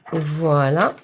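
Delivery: usual speaking voice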